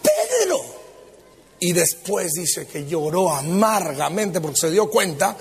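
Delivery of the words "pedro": high, shocked cry of "Pedro"; scandalized exclamation. Acted. Preacher scandalized at Peter's cursing, high shocked cry. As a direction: gasp out the name in mock scandal.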